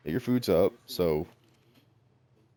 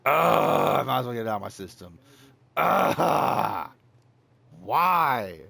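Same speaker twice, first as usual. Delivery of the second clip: real frustrated groan; 6 semitones higher, 6 dB louder, slower than usual. Real, not acted. Streamer upset over lost meat.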